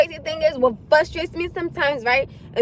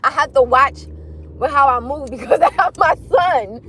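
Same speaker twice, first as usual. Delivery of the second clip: ordinary talk, nothing marked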